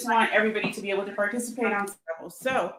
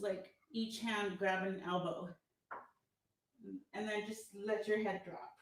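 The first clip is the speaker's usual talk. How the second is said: soft, calm stretch instructions; soft stretching guide; 9 dB quieter than usual. Acted. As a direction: guide the stretch gently and quietly. Leads a gentle stretch.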